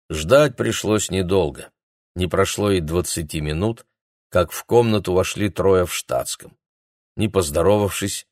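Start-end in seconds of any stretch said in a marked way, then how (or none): none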